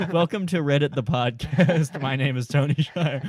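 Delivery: loud hammed-up show intro; podcast host intro. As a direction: announce it like a radio host. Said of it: "mock podcast intro".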